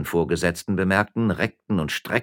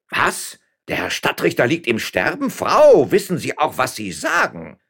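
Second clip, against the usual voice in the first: high, alarmed exclamation swelling on "Frau"; shocked disbelief; 9 semitones higher, 7 dB louder than usual. A narrator's reading. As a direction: demand to know, aghast. Mayor hears shocking news.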